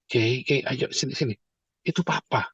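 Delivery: soft, low fatherly coaxing; hushed parent voice, no scolding; gently calling daughter. Retold or imitated. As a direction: quietly beckon a child over. Father gently calls daughter over.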